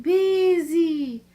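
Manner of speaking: the wind's voice, high and loud; eerie moaning wind chant; howling wind. A narrator's reading. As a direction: howl it like a storm wind through the chimney. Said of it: "the wind blowing".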